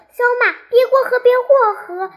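high-pitched, rushed warning from a squirrel; squirrel's excited cry of alarm, pitched well up; urgent warning cry. A narrator's reading. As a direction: call out fast and high to stop someone in danger. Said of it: "拦住他大叫".

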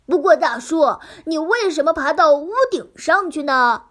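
loud curious piglet. Acted. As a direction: ask with childlike curiosity. Piglet asks loudly.

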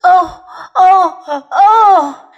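loud, high acted sobs; sobbing. A narrator's reading. The faun wails to Lucy, sobbing. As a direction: wail each "oh" as a sob. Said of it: "sobbed Mister Tumnus".

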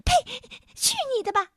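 high, squeaky scornful outburst; rude little creature. A narrator's reading. Little man rejects the king's offer, rude high squeal. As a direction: spit it out rudely in a tiny voice. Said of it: "小人儿粗野地叫喊着".

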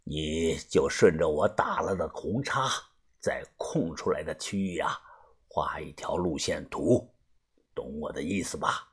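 low, hushed, nearly whispered instructions; lightly voiced old boss. A narrator's reading. Boss tells helper to draw a route map, hushed low voice. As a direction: speak low and soft, as a tired elder.

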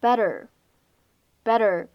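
"better" with the double T as a quick flap, slow; American "better", flapped T, drawn out. Shown as a demonstration. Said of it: "这样一个单词我们读成".

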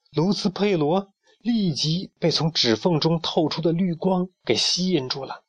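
ordinary delivery

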